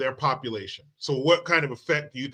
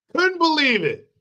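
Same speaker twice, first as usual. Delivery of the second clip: high, louder outraged disbelief; 11 semitones higher than usual. Shown as a demonstration. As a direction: throw it out, indignant.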